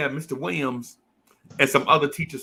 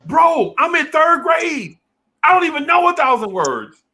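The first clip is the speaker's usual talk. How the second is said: high, loud, slow protest of a third grader; outraged kid; 12 semitones higher, 4 dB louder, slower than usual. Retold or imitated. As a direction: wail it like a shocked little kid. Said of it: "You like".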